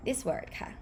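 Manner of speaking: normal talking voice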